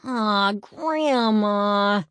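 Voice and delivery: put-on high, loud childish whine; whining. A narrator's reading. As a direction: whine it like a kid who doesn't want to go. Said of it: "Eddie whined".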